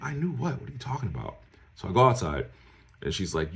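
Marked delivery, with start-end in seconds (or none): 0.0-1.4 high-pitched baffled reply, falling at the end; retold bewilderment in a high voice; baffled retort. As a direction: act out being totally confused.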